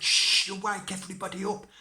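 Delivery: loud, high stage shush; urgent hushing. Acted. Robbers hush Tom in the sleeping house, high urgent shush. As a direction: shush him, but far too loudly.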